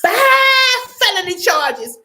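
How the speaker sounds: high laughing through tears; laughing disbelief. Real, not acted. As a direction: howl with laughter at the number. Laughing at absurd news.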